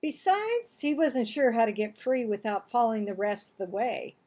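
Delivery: ordinary delivery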